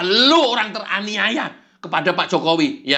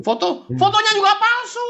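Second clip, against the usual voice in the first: much higher, insistent whine; high devil voice; 13 semitones higher than usual. Acted. Devil insists the photo is fake too. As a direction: push the lie in a squeaky voice.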